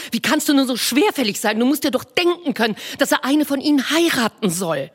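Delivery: Mrs Bennet voiced high and bright-eyed, fussing; a high, eager matron gushing to her husband; eager wife. Wife to her husband with news, eager high voice.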